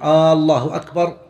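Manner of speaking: "الله أكبر" opened with a long interrogative "aa"; question-making long vowel. Shown as a demonstration. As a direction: stretch the first vowel of "الله أكبر" to "aaallahu". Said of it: "يقلب الجملة الخبرية إلى استفهامية".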